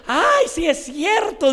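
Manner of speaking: loud, high, drawn-out mock surprise; imitated "oh, that's right!" outburst; acted sudden realization. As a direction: exclaim it as the lady suddenly remembering. Voices lady's sudden realization.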